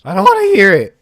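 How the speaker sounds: loud annoyed protest. Real, not acted. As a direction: push back loud and annoyed. Original protest.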